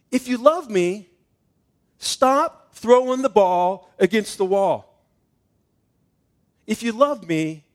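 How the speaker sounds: slow, loud, every word pressed out; stern warning. Retold or imitated. Father gives his son a slow, stern, loud final warning. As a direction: lay down the law deliberately.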